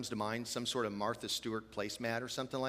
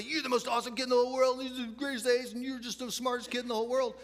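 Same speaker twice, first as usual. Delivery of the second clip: high, fast gushing parent voice; gushing praise; 12 semitones higher, 4 dB louder than usual. Retold or imitated. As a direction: pile on the praise like a doting parent.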